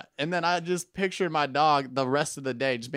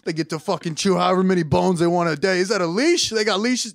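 slightly higher, louder jealous whine; a dog marveling at the neighbors; envious dog voice; 5 semitones higher, 5 dB louder than usual. Retold or imitated. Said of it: "Be like".

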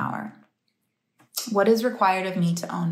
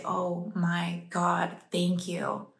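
soft and slow reverence; slow, softly reverent recollection; hushed, grateful awe; 3 semitones lower, 8 dB quieter, much slower than usual. Retold or imitated. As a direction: breathe out quiet thanks. Relives grateful awe while meditating.

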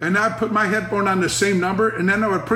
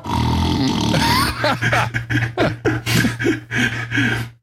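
breathy laugh; 4 semitones higher than usual. Real, not acted. Laughing at own prank.

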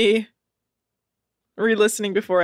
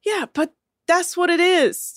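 ordinary talk, nothing marked

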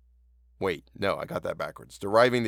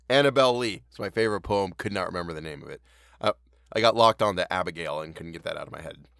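plain reading voice

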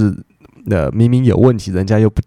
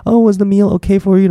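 bright, much higher polite waiter question; high chirpy waiter; 10 semitones higher than usual. Retold or imitated. Teacher acting the English waiter asking, high and chirpy. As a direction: sing out the question like an eager waiter. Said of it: "你问他说".

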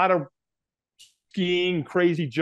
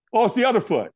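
retold sudden realisation; 8 semitones higher, faster than usual. Acting out checking for burns.

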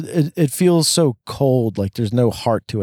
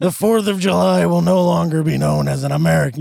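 rousing presidential speech, higher; Bill Pullman impression; 6 semitones higher than usual. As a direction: deliver it like a wartime president. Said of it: "that's my Bill Pullman".

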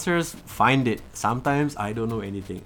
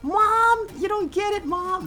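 put-on high, whiny teenage yell; screamy teen whine; slower than usual. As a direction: shriek it like a bratty teen. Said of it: "especially during the screamy".

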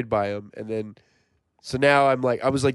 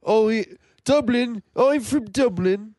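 put-on Irish accent; 7 semitones higher, slower than usual. Second try at an Irish Bono for friends, slow high bad Irish accent. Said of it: "I can't do an Irish accent".

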